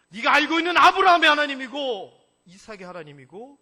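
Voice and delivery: booming proclamation. Acted. God booming to Moses.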